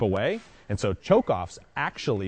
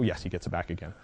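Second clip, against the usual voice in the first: usual speaking voice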